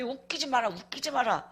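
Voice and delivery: low, quiet dismissal; scornful muttered retort. Retold or imitated. Wife inwardly rejecting husband's apology, quietly scornful. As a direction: drop low and shut them out.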